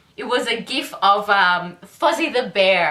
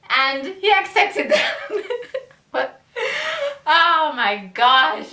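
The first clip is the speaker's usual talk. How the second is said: laughing; 7 semitones higher, slower than usual. Real, not acted. Laughing at the ending.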